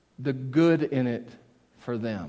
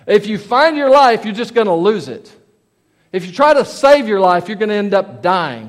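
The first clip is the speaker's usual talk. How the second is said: higher, louder scripture proclamation; punched-up preacher's quote; emphatic preaching; 4 semitones higher, 8 dB louder than usual. Acted. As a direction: proclaim the saying to a crowd. Preacher delivers Jesus' saying.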